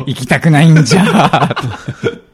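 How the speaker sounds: put-on slow, stubborn old man, half laughing; impatient old geezer. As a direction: whine it like a grumpy grandpa, giggling. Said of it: "せっかちじじい".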